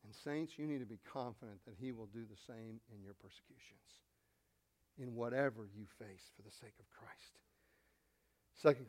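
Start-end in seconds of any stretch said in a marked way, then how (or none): 1.8-7.4 whisper. Real, not acted. Intimate exhortation to the saints.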